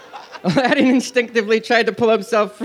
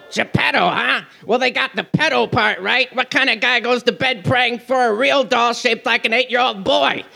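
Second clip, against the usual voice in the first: higher, cartoonish heckler; incredulous cartoon parrot, pitched up; wisecracking parrot sidekick; 4 semitones higher than usual. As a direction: squawk out the wisecrack.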